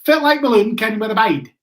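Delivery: a light mock Aberdeen accent from a local bloke; imitating an Aberdeen accent. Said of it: "this bloke came up to me and he goes".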